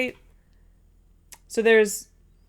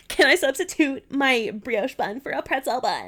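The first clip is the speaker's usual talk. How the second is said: higher-pitched snooty customer voice; little skinny bitch; 5 semitones higher than usual. Shown as a demonstration. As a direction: lift the pitch into a put-on bitchy request. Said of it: "a little skinny bitch".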